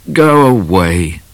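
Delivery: sulky. A narrator's reading. Hurt Midnight sends Cat away, slow and sulky. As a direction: push Cat away, sulking.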